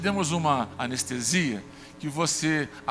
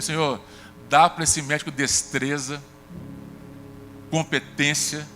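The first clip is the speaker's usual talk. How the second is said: fervent prayer, a bit louder; retold prayer; 3 dB louder than usual. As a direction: pray it out with conviction for the doctor. Pastor retells prayer before surgery.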